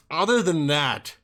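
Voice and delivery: ordinary talk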